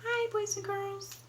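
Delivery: high, drawn greeting from a puppet. Pretend. Elephant puppet greeting the children, high puppet voice. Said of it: "I have my puppet".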